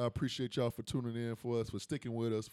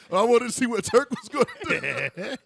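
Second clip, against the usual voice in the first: laughing; 9 semitones higher, 9 dB louder than usual. Real, not acted. Laughing through excuse.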